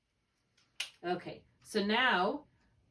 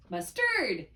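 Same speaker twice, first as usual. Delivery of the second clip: "Mustard" as mus-TURD, end punched; "Mustard" wrongly accented on TARD; stress on second syllable. Shown as a demonstration. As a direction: lean hard on the last syllable of "Mustard". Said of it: "say it both ways".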